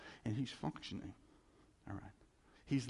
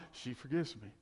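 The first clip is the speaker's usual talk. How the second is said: usual speaking voice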